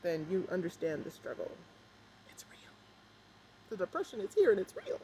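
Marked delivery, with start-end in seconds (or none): 2.3-2.7 whispered it's real; whispered aside to viewers. Real, not acted. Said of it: "whisper after struggle". 3.7-5.0 loud, high comic shout; hammed-up comedian yell; Kevin Hart impression. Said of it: "Kevin Hart".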